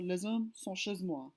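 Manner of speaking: les hommes with the S linked as Z, lez-om; French liaison. Shown as a demonstration. Teacher reading the liaison example, French. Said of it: "the counterpart".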